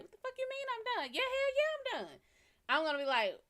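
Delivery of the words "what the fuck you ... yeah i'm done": sharp, much higher mock-outraged reply; pissed-off retort. Imitating her own pissed-off reply to a friend who says she's done. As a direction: snap back like you're offended. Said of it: "I'm gonna be pissed off".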